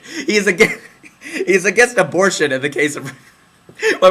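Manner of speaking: laughing through the words, pitch lifted; amused, stumbling with laughs; cracking up. Real, not acted. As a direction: break off as laughter takes over. Comedian cracks up mid-joke.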